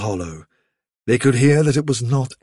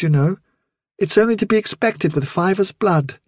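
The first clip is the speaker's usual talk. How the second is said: higher-pitched mother's voice; higher, chatty mother rabbit; 6 semitones higher than usual. Acted. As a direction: speak up as the mother, in a higher register. Vilthuril explains her youngster's gift.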